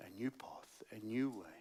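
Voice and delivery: a whispered hint of hope. Real, not acted. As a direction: breathe it as a hushed promise. Hushed aside on a new path.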